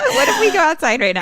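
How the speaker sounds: excited. Real, not acted. Excited at scary idea.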